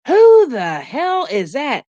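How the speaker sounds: drawn-out, incredulous question; slow mock disbelief; retold bafflement. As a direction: imitate a baffled, indignant reaction. Retelling her baffled reaction.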